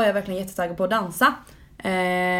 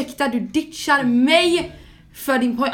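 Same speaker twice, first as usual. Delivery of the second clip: high, loud, drawn-out indignation; outraged friend, hammed up; mock outrage; 4 semitones higher, 4 dB louder than usual. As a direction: play up the offended disbelief at being ditched. Acting out her outraged reply to friend.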